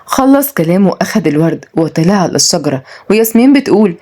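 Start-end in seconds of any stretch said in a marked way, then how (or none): none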